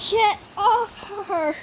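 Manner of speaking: high, drawn-out telling-off; exasperated scolding. Real, not acted. Player scolding the dog for chewing wire, exasperated. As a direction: call it out slowly, exasperated.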